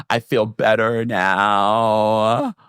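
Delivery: very slow, drawn-out put-on voice; mock relief. Mia claiming she feels better, mock relieved. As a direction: drag out the last words in mockery.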